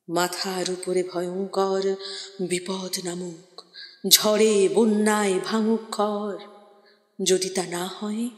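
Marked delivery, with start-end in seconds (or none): none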